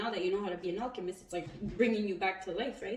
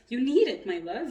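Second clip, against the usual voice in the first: laughing tease; 4 semitones higher, 8 dB louder than usual. Real, not acted. Laughing, mocking tender tone.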